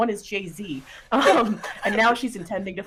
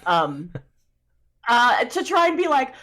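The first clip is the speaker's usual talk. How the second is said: usual speaking voice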